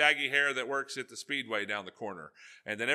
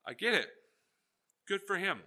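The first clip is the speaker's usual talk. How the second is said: normal talking voice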